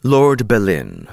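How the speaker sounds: usual speaking voice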